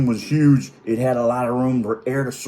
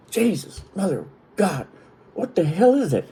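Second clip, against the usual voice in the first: appalled disbelief; 7 semitones higher than usual. Retold or imitated. Retold disgust at the smell.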